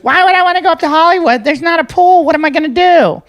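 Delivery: high mock whiny young woman; imitating a whiny girl. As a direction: pitch it way up like a spoiled girl complaining. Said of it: "She goes".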